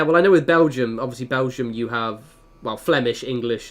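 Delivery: normal talking voice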